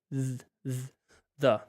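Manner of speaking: "Z, z, the" with a buzzing voiced th; voiced th demo. Shown as a demonstration. Teacher shows viewers the voiced th sound. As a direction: buzz the z into "the". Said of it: "more sound with that T H".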